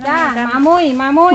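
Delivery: calling out. Real, not acted. Calling out the plant name.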